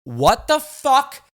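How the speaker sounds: high, slow and loud disbelief; incredulous outburst. Retold or imitated. To his family after their absurd question, slow high incredulous yell. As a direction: drag out the what in disbelief.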